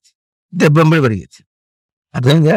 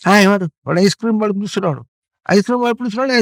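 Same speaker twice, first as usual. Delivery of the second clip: put-on higher, fast eager child voice; excited child; 7 semitones higher, 3 dB louder, much faster than usual. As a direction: blurt it out like a kid who hears the ice cream man. Child excited about ice cream. (ఐస్ cream కోసం ఉత్సాహంగా అరిచే పిల్లవాడు)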